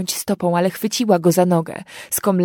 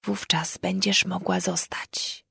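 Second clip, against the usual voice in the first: soft, slow, whispered offer; gentle whisper; 7 dB quieter, slower than usual. A narrator's reading. Fairy woman softly promising the girl she may stay, whispered. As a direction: whisper it gently, almost kindly. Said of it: "Jej głos po raz pierwszy zabrzmiał łagodnie".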